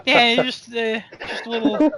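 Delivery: ordinary talk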